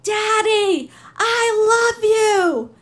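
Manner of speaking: put-on high, loud, drawn-out cry of a little girl calling her daddy; child's tearful scream. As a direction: scream it through tears like a four-year-old at the prison window. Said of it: "she screams".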